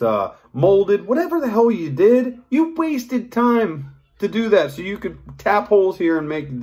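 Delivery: venting at the knife cloner; fired-up rant at a copycat maker; worked-up rant. Real, not acted. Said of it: "I'm getting worked up".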